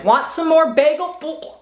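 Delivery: high, slow, sing-song mom voice; a sudden sweet motherly call from the doorway. Retold or imitated. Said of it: "If my mom had walked in".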